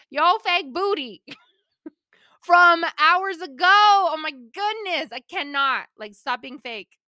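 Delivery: high, loud opening that settles down; hammed-up exasperated call-out; sassy rebuke. Host playfully calling out fake people, sassy rebuke.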